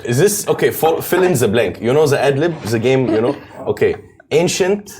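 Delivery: put-on quiz-game patter in an Egyptian accent; put-on Egyptian-accented English.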